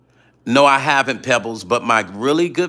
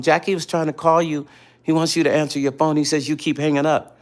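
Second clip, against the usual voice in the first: lightly voiced kid, higher; little cousin; 6 semitones higher than usual. Kid cousin relaying Jackie's call, higher voice. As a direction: lightly imitate a child passing on a message. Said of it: "you know he's a kid".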